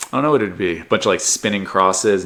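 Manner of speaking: ordinary delivery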